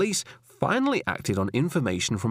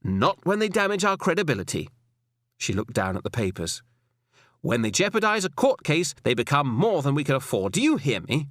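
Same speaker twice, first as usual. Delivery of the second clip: higher woman's voice, measured and firm; firm female superior; 4 semitones higher than usual. Acted. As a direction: lecture him with cool authority. Alison warns Roy.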